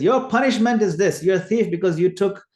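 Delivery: mock-stern verdict called out loudly; affectionate mock scolding. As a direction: pass playful sentence, high and loud. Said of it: "not rebuke, but out of affection, just to tease".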